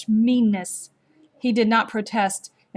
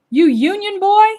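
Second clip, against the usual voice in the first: much higher, louder sneering taunt; jeering; 11 semitones higher, 9 dB louder than usual. A narrator's reading. Soldier jeering at the boy over his uniform, high loud sneer. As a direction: jeer at him with mock scorn. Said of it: "one jeered".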